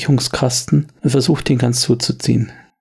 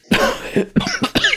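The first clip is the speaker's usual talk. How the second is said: hohes, würgendes Husten; gequältes Husten. Acted. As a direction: ein Geschlagener hustet keuchend. Verletzter Mann. (hohes, gequältes Husten)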